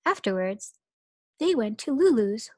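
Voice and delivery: usual speaking voice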